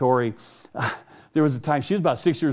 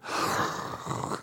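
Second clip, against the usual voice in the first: deflated sigh; 8 dB quieter than usual. Real, not acted. Realizes dog's name.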